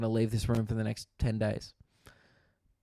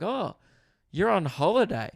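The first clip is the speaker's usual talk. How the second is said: much higher, a little louder, delighted guess; chirpy old neighbour; 7 semitones higher, 6 dB louder than usual. Retold or imitated. Old couple guessing a holiday, high and bright. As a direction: perk up like a pleased retiree. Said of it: "They're like".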